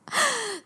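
high embarrassed giggle; embarrassed laugh. Real, not acted. Embarrassed laugh after confession. (尴尬地笑)

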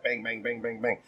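each "bang" hit evenly like a shot; rapid-fire even beats on "bang"; robotic "bang, bang" delivery; flat staccato. Shown as a demonstration. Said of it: "like a bullet from a gun".

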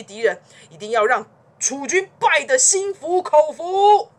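loud, high, indignant protest; unhesitating refusal. A narrator's reading. Song's ruler refusing his adviser's sneak attack, indignant. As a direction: reject it outright, building to a ringing finish. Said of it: "毫不考虑地说".

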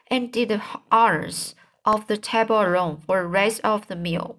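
ordinary delivery

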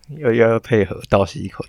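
loud. Pretend. Teasing co-host's fake surprise cue.

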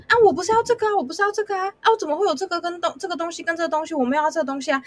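a customer wailing that it's not what she ordered; high whiny complaint. Retold or imitated. Customer whining at the counter about the wrong order, high and protesting.

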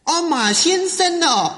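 put-on high, slow old-lady voice; puzzled mother-in-law. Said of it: "我这个岳母啊".

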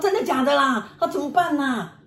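high, whining mock panic; imitated panic. Husband believing the ghost story, imitated panic. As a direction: wail it as a scared husband would. Frightened husband in retold scene.

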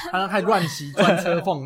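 laughing. Real, not acted. Laughing while setting the scene.

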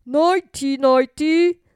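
loud soothing father; a father saying goodnight; king voice. A narrator's reading. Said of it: "said the king".

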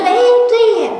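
high surprised exclaim; a loud, high "oh right!". Retold or imitated. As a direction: pitch jumps up in sudden realisation. Sudden realisation.

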